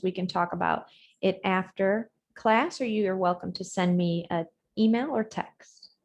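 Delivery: ordinary talk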